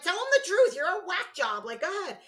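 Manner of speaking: high, loud teasing dare; egging her on. Retold or imitated. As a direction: push her on like a giggling friend. Maddie laughing and egging her on.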